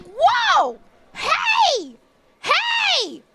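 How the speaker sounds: high-pitched mock protest; retold scolding interjections. Streamer retelling her reply to Richard's insult, high scolding calls. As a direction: halt them with high, stretched calls. Retells cutting off cranky friend.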